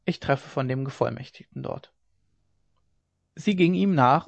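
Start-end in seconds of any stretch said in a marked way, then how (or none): 0.0-1.9 murmured. A narrator's reading. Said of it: "murmelte er vor sich hin".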